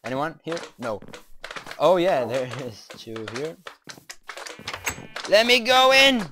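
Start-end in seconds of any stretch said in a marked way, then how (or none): 5.3-6.2 excited. Real, not acted. Excited to get in.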